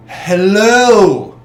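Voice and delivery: loud, high, drawn-out hello; big stretched greeting from a pompous guest; hammed-up greeting. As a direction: boom out a theatrical hello. Voicing Volo after being hit.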